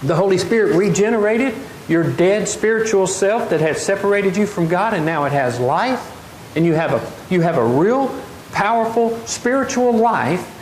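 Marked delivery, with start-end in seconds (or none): none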